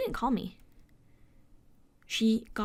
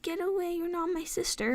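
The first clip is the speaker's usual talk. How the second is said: put-on child's outburst; 8 semitones higher than usual. Little sister yelling at her.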